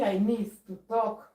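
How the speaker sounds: ordinary talk, nothing marked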